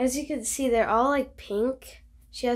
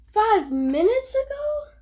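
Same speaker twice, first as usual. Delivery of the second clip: much higher, louder incredulous echo; shocked disbelief; 11 semitones higher, 7 dB louder than usual. Acted. Gerald echoing Piggy's answer in high shocked disbelief. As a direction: repeat it in total disbelief.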